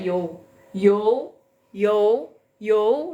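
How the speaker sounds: "yo" pulled straight upward in pitch; "yo" as a rising-tone model syllable; rising second tone. Shown as a demonstration. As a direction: start "yo" mid and sweep it up. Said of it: "langsung ditarik ke atas".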